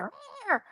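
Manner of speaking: mock feisty "rah" growls; imitated cat snarl. Imitating the panther's answer to sweet talk, mock snarl. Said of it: "And she's like".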